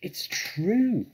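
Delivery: high, loud little-fox voice; youngster's high-pitched cry; small foxes crying. A narrator's reading. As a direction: pipe up high and eager. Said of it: "cried the small foxes".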